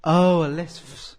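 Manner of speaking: drawn-out hissing S on "lisp"; mocking a lisp. Making fun of co-host's lisp, mocking with a long S. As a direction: stretch "lisp" slowly into a long, loud hiss.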